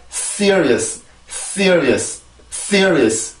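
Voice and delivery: "Serious" as crisp English, strong s, stressed first syllable; English pronunciation demo. Shown as a demonstration. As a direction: say "Serious" English-style in three quick syllables, falling. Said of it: "다시 한번". (영어식 "Serious" 발음 시범)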